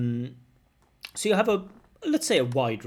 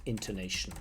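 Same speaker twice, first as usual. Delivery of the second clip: ordinary talk, nothing marked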